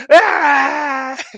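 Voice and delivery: high, loud shouted name; scream Eric. Shown as a demonstration. Streamer shows chat how to scream her name. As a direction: yell the name across a room. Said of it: "just scream Eric".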